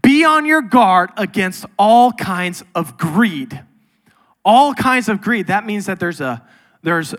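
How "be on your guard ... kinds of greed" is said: loud, slow, emphatic sermon delivery; full-force pulpit preaching; forceful preaching. Acted. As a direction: drive the warning home to the congregation. Preaching a Jesus quote.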